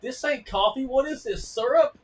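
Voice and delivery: high, loud outraged squeal; husband rejecting the coffee, high and loud; mock disgusted husband. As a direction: shriek of disgust rising on syrup.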